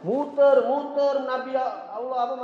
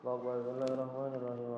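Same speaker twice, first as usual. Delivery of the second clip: murmured prayer; 8 semitones lower, 11 dB quieter, faster than usual. Retold or imitated. Mimicking a worshipper starting prayer.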